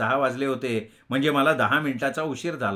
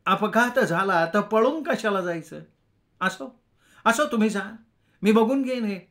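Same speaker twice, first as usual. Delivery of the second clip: placating bystander; 7 semitones higher than usual. Acted. Desai intervenes in the quarrel.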